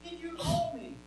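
high, hushed questioning; feed the line under your breath, pitched high; soft prompting. Shown as a demonstration. Teacher quietly prompting young Samuel with his line, hushed.

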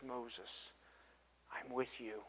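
hushed voice of a consoling God; gentle reassurance. Acted. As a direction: lean in and comfort him quietly.

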